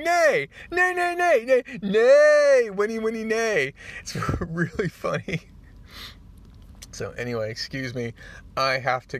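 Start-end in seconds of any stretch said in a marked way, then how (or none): 0.0-3.7 very high, loud comic whinny; horse neighing. Acted. Spider-Man talking horse as others hear it, absurd shrieking neighs. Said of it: "Spider-Man's going". 4.0-5.4 higher-pitched, laughing through the words. Real, not acted. Narrator cracking up at the neighing joke, laughing as they talk.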